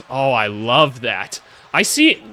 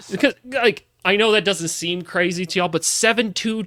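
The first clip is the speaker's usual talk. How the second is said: normal talking voice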